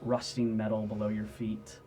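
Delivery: hushed, measured game master narration; atmospheric scene setting. Acted. Game master describes a creaking climb, atmospheric narration. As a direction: set an ominous scene with pauses between phrases.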